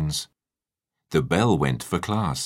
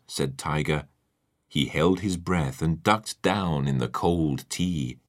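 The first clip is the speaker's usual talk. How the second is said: usual speaking voice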